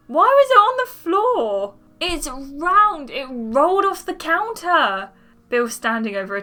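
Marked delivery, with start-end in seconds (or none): 0.1-1.7 very high, loud shriek of protest; outraged yell. Acted. Cook who stepped on a tomato, yelling back. Acted quarrel over squashed tomato. 2.0-5.1 loud, high, exasperated comeback; indignant retort. Acted. Tomato's owner to the one who stepped on it, indignant.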